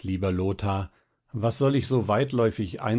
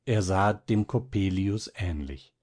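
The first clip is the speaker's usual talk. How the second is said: ordinary delivery, nothing marked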